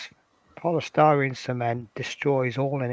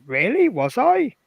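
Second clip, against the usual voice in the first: high, louder questioning disbelief; surprised reply; 9 semitones higher, 4 dB louder than usual. Retold or imitated. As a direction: act out your own amazed reply. Retells own surprised reply to receptionist.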